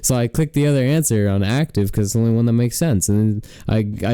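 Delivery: ordinary delivery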